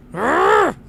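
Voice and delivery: loud, rising "arrgh" of rage; hammed-up exasperated roar; frustrated growl. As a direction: growl in comic road rage. Grumpy driver losing his temper.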